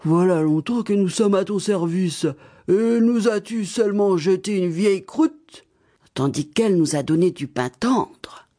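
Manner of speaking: lightly voiced grumbling dogs; complaining dogs. The beaten dogs reproach Baba Yaga, grumbling voice. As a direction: grumble back at the mistress who starved you. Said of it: "dirent les chiens".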